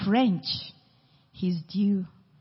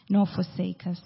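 ordinary delivery

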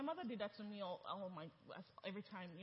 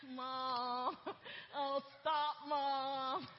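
embarrassed laughing; 5 dB louder, much slower than usual. Retold or imitated. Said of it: "you're like".

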